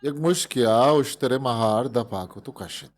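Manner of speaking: usual speaking voice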